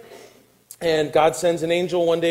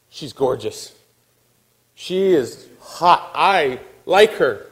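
slow, hammed-up admiration; smitten young man; 3 dB louder, much slower than usual. Samson first seeing the Philistine woman, slow smitten admiration. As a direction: savour each word, utterly smitten.

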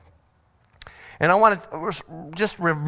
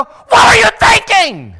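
high, drawn-out angry yell; furious scolding shout; 13 dB louder than usual. Acted. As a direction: explode at the child you just saved. Scared parent scolds the rescued child.

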